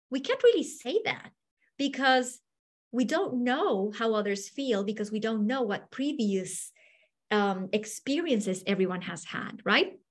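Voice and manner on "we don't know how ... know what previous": ordinary talk, nothing marked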